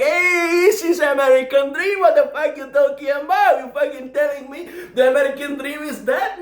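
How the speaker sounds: high, loud, indignant yell; outraged outburst. Retold or imitated.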